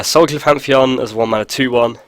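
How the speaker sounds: normal talking voice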